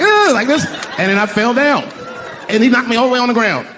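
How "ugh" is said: loud, high grunt of disgust; mock disgusted ugh. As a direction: recoil with an ugh. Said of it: "I was like".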